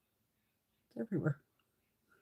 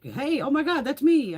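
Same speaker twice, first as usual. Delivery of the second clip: high, louder mock excitement; imitated excited winner; 8 semitones higher, 5 dB louder than usual. Imagined winner answering the live hosts, excited. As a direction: pipe up as if you just won.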